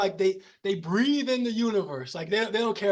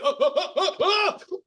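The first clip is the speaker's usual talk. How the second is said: startled crashing monk; 8 semitones higher, 5 dB louder than usual. Retold or imitated.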